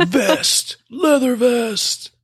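high, slow, hammed-up macho voice; mock macho voice. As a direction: drawl it like a tough guy. Joking about biker gear.

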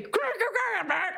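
high, garbled grunts round a bit; mock gagged gibberish; bit in mouth. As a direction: try to talk with your mouth wedged open. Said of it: "'cause there's a bit in my mouth".

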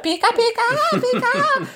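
tiny, very high squeaky creature voice; Pikachu. Retold or imitated. Playing the original Pikachu actress, tiny high voice. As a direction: imitate a little Japanese girl voice, sky-high. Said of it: "that little Japanese girl voice".

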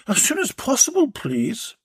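polite caller trailing off; Belinda's voice. Acted. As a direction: ask politely, please almost breathed. Belinda answers the tradesman.

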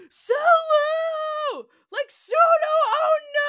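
high, very loud screamed name; panicked scream. Retold or imitated. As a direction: shriek for Sulu in terror. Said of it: "and then screams".

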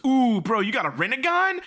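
high, loud excited shout. Retold or imitated. Naruto gushing at the old sage's eyes, excited shout. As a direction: yell it like a kid spotting something cool. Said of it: "but Naruto goes".

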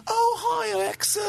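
high, squeaky mock Cockney greeting; teasing Cockney impression, pitched high. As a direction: imitate her thick Cockney "Oh, hi, Alexa". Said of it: "what's that accent".

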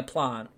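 low plant as "plahnt"; South Australian PLANT. Shown as a demonstration. Declaring they are South Australian, long "ah" in plant. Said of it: "I'm South Australia".

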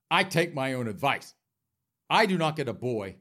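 ordinary talk, nothing marked

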